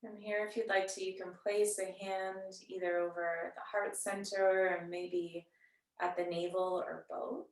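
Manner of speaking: soft, unhurried yoga-teacher voice with long pauses; guided meditation. Real, not acted. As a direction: gently guide them into settling and noticing the breath. Leads seated breathing meditation.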